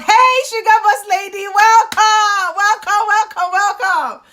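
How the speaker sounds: light up and welcome her in; excited welcome. Real, not acted. Host greets a returning viewer joining the live, excited.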